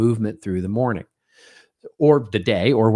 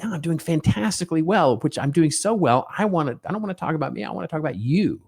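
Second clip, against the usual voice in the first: enthusiastic answer; 7 semitones higher than usual. Retold or imitated. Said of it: "what if you went".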